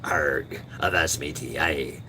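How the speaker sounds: a hint of a gruff pirate; pirate talk. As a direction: toss out pirate lines lightly. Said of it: "I look like a damn pirate".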